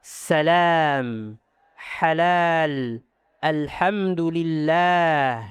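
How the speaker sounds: long open mouth vowels in "Salam, halal, alhamdulillah"; slow, free "a", mouth wide; released open a. Shown as a demonstration. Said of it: "lepas a".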